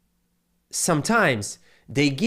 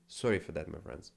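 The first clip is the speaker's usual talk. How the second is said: much softer, lower apology; hushed sorry to the viewers; quiet apology; 9 semitones lower, 11 dB quieter than usual. Real, not acted. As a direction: drop the volume right down. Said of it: "lower the sound a little bit".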